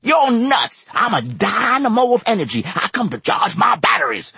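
high-pitched, loud, wound-up insisting; a man bursting with his own energy, shouting it; frantic high shout; insistent high shout. A narrator's reading. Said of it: "one of the vets insisted".

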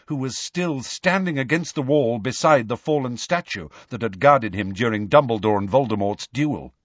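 ordinary delivery

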